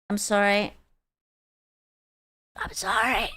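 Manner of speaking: soft apology fading away; sheepish apology. Real, not acted. Apologising while cleaning the spill.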